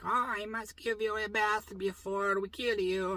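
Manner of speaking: soft, put-on creepy captor voice; creepy man voice. Basement captor to captive Wesley, soft and creepy. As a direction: purr it like a creepy jailer. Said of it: "I don't really talk like that".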